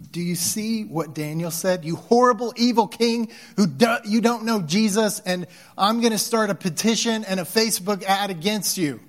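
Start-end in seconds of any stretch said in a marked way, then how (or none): 1.8-9.0 higher, louder, scornful mock outrage; mock self-righteous rant. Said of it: "horrible, prideful, righteous".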